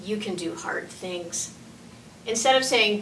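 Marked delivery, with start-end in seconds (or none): 0.0-1.5 much softer, reassuring; gentle encouragement. Shown as a demonstration. Parent encouraging a struggling child, gentle and soft. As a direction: encourage quietly.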